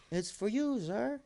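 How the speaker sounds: high little gnome voice, polite. Retold or imitated. Little gnome handing over the golden phone, high voice. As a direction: squeak it up like a tiny gnome offering a phone. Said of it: "and the gnome goes".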